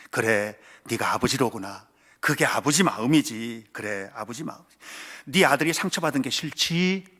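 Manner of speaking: lightly voiced God comforting the father; God's voice. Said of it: "하나님이 말씀하시는 거예요". (하나님이 아버지를 달래는 낮고 느린 목소리)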